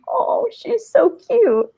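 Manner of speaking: high, slow, gushing sweetness; cooing at the toddler who rubbed her leg, high and slow; gushing over a cute kid. Retold or imitated. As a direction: coo it like you're melting over her. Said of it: "and I'm like".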